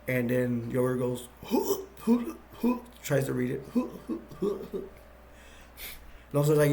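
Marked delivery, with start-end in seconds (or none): 1.4-4.8 airy high throat-clearing huhs. Retold or imitated. Yogurt pretends to read, clearing his throat in huffs. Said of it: "No, I'm just clearing my throat".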